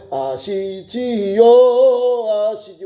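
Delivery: very slow, stretched "아시지요"; drawn-out plea. Acted. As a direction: linger on every syllable of "아시지요". Pleading with God, drawn out. ("아시지요"를 길게 늘이며 간절히)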